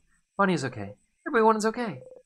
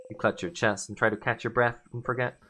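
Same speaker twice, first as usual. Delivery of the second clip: ordinary talk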